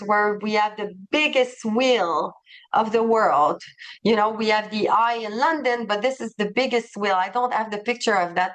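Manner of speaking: normal talking voice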